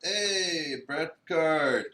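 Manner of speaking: loud, drawn-out hail; excited greeting. Real, not acted. Co-host hailing a friend joining the stream, loud and drawn out. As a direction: call his name like a big welcome.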